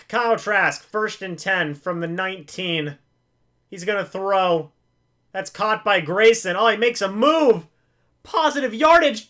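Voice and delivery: sportscaster calling a live drive; play-by-play call. Acted. Mock play-by-play of the game.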